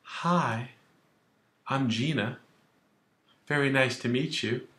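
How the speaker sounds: lightly voiced polite young woman, soft; a soft, sweet hint of a young woman introducing herself; young lady's reply. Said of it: "She can say".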